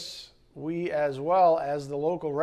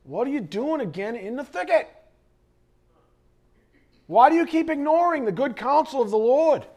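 higher, rising to a loud rebuke; angry scolding; 9 semitones higher, 5 dB louder than usual. Retold or imitated. Shepherd rebuking the sheep in the thicket, angry. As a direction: scold the wayward sheep hard. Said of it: "Like anger".

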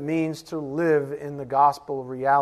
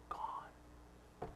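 breathy whispered echo; a whispered gone; 25 dB quieter than usual. Acted. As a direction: let the word vanish in a whisper.

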